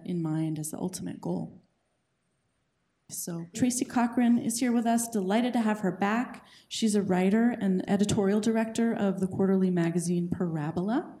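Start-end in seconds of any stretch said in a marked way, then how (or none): 3.1-11.2 loud, upbeat announcer welcome; host introduction. Acted. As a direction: present the guest to the room. Host introduces guest speaker.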